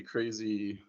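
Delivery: ordinary talk, nothing marked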